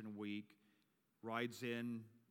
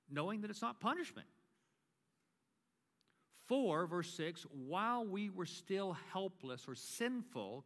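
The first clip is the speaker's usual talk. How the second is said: emphatic preacher, higher-pitched, with weighted key words; preaching; 6 semitones higher, 5 dB louder than usual. Acted. Pastor preaching to the congregation on Christ's death, emphatic preaching. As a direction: drive each phrase home to the congregation.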